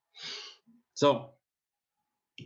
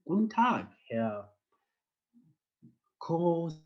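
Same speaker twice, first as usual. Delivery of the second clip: hesitant greeting; 7 semitones higher than usual. Acted. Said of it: "There's, of course, hesitation".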